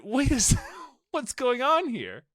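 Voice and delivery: thrown for a loop, voice way up; baffled reaction. Retold or imitated. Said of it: "and I was like".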